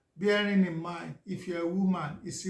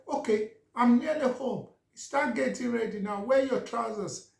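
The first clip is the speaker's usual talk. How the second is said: higher, louder commands; bossy wife orders; 5 semitones higher, 5 dB louder than usual. Retold or imitated. Wife on the phone orders husband to dress, louder and bossy. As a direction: hurry him up firmly.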